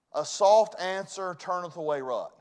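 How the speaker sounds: scripture preached out. Shown as a demonstration. Scripture quoted at full voice.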